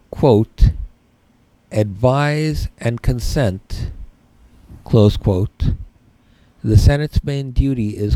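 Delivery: slow, spaced-out dictation pace; dictating. Shown as a demonstration. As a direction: read it slowly for note-taking. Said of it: "Write this down".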